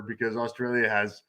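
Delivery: usual speaking voice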